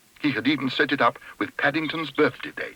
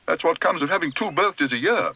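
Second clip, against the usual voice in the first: higher-pitched, lightly voiced interjection; boy breaking in; 6 semitones higher than usual. A narrator's reading. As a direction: break in brightly with a verdict. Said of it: "broke in Jonathan".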